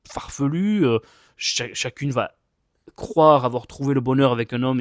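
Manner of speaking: ordinary talk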